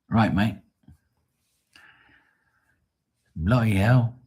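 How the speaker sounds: playful put-on British accent; mock English accent. Teasing Matt after offering a hug, mock English accent. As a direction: toss it out like a pretend Englishman. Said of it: "when I talk like, like an English person".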